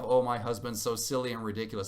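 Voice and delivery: ordinary talk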